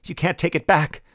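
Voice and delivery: high, urgent protest; cried. A narrator's reading. Veiled woman protesting to the adjutant. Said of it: "she cried".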